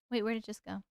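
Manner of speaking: ordinary delivery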